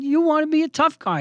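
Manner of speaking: high-pitched challenge; taunting. Retold or imitated. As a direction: goad the tough guy. Taunting the bully in retelling.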